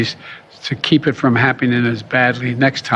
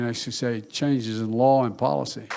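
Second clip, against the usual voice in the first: ordinary talk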